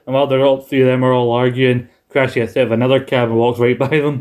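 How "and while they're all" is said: usual speaking voice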